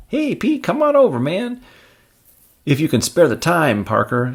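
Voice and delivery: high, loud call-out; taunting student. Acted. Randy's pal calling out to Peter, taunting. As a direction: hail him with a sneer.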